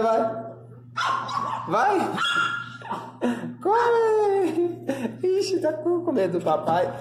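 very high cooing encouragement. Real, not acted. Owner urging the scared dog to eat, in high baby talk. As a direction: sweetly urge the scared dog toward its food.

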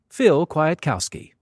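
ordinary delivery

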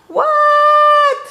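very high, loud, stretched yell; yelled reply. A narrator's reading. Froggy answering Dad from his bike, high loud yell. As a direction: holler back at a high pitch.

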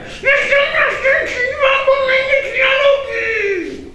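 high loud wail with a laugh in it; repeating octopus. A narrator's reading. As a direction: repeat the plea, laughing through it. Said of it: "trzy razy powtórzyć".

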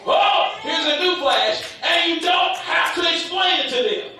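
high, emphatic pulpit delivery; forceful preaching. Real, not acted. Preacher telling believers they needn't explain, forceful. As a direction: preach it out with rising fervour.

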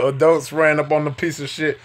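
ordinary talk, nothing marked

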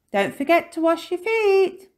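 high, loud reminder; calling out. A narrator's reading. Missus Topsy-Turvy reminding about washing, calling out high and loud. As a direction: call a reminder to the children.

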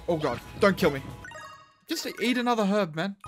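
ordinary talk, nothing marked

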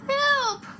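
high, loud squeal; shrill storybook cry; witch crying for help. A narrator's reading. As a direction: yelp for help as the panicked witch. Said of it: "cried the witch".

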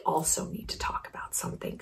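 hushed conspiratorial whisper. Acted. Whispering a secret to her viewers, hushed.

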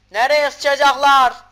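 loud, high, slow yell; exasperated shout. Real, not acted. Gamer yelling at the game's weird layout. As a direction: shout it out in disbelief.

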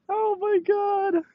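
very high, slow squeal after laughing; helpless high-pitched amusement; laughed out. Real, not acted. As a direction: wheeze it out at the end of a laughing fit.

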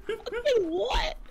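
high, slow laughing mock snap; mocking defensive. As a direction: parrot it back defensively, laughing. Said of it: "you sounded so defensive so quick".

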